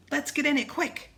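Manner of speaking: urgent. A narrator's reading. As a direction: urge a quick escape. Urging escape into the canoe.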